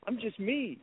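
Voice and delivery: modest disbelief. Retold or imitated. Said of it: "and I'm like".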